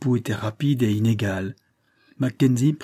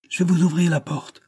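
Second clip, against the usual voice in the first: mildly raised, high-pitched call; higher-pitched, restrained cry; 8 semitones higher than usual. A narrator's reading. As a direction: call out higher, without really shouting. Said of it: "cria-t-elle".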